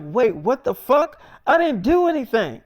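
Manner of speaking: retold disbelief, high and stretched out; indignant protest. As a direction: protest innocence, voice climbing as it goes. Acted protest at being arrested.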